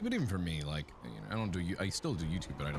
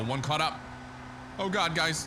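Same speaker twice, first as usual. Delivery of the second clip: panicked; 9 semitones higher than usual. Real, not acted. Monster catches up in game.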